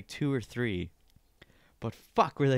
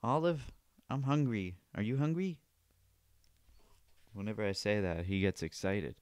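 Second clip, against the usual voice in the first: ordinary talk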